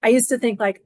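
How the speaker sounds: usual speaking voice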